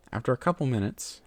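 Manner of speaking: ordinary delivery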